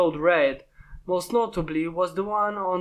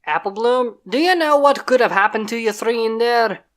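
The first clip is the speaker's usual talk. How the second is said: louder big-sister scolding; 3 semitones higher, 5 dB louder than usual. Acted. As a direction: tell off a little sister. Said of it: "started to scold them".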